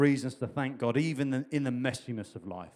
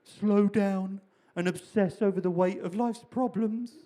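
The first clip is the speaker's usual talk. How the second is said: mild mock-weepy voice, higher and very slow; understated gloomy Sadness voice; Sadness quoted; 6 semitones higher, slower than usual. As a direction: droop through it like a glum Eeyore. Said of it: "she's crying".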